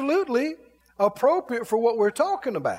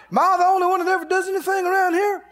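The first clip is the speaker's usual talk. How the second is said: fast, higher mock whine; whiny complaint; 9 semitones higher, 4 dB louder, much faster than usual. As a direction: rattle off a petty gripe. Imitating complaining worker.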